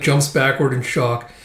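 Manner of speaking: ordinary delivery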